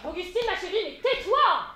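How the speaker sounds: sharp scolding shout. Acted. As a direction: cut her off loudly. Mother scolding daughter loudly.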